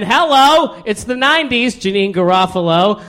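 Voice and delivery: loud, high showy greeting; mock grand reveal. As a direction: hail the new decade with a hammed-up flourish. Hammed jump in his life story.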